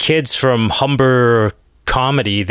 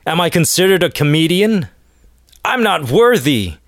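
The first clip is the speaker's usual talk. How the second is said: surprised; 6 semitones higher than usual. Retold or imitated.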